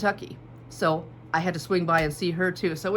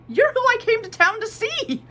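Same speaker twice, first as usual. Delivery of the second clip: much higher and louder, thrilled; telling her classmate she came to see her; excited reply; 6 dB louder than usual. Retold or imitated. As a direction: blurt it out, overjoyed.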